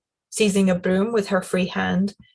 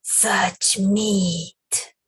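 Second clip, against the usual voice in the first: brutal creature. Acted. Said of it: "responding brutally".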